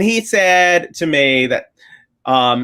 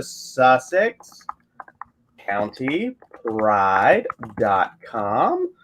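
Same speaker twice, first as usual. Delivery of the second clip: very slow, word by word with long pauses; typing-out dictation; 6 semitones lower, 3 dB quieter, much slower than usual. Real, not acted. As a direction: dictate it slowly as you type, lifting at the end. Slow dictation while typing out address.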